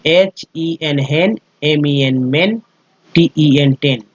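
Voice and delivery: letter by letter, slowly: हेन, मेन, टेन; slow spelling drill. Shown as a demonstration. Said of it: "तो एन पढ़ेंगे". (धीमी spelling drill)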